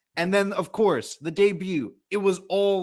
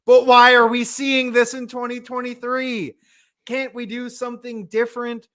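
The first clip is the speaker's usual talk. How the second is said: exasperated rant; 8 semitones higher, 6 dB louder than usual. Real, not acted. Ranting, higher and louder.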